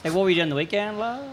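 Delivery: casual question with a lazy "la" on the end; slangy "la" ending. Shown as a demonstration. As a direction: lift the final "la", open vowel and no k. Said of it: "so we just say la".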